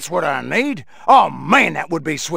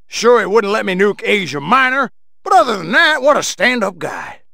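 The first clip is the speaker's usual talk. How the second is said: ordinary talk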